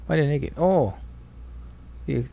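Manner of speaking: ordinary talk, nothing marked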